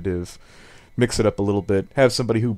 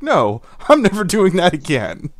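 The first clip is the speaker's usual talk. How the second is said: laughing refusal; 6 semitones higher, 5 dB louder, slower than usual. Retold or imitated. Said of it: "He's like".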